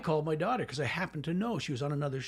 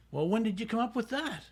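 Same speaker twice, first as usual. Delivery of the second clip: incredulous protest; 8 semitones higher, 4 dB louder than usual. Retold or imitated. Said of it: "Sheridan comes up to me and goes".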